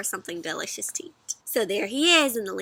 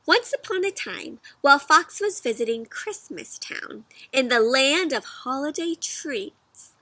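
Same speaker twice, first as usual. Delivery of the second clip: lilting, high story opening; reading aloud to children; storybook reading; 3 semitones higher, slower than usual. A narrator's reading. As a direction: read it like a bedtime story. Reads the story text.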